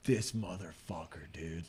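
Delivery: low, slow, very soft aside; muttered amazement. Retold or imitated. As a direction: mutter it in retold disbelief. Retold family marvelling at the boy.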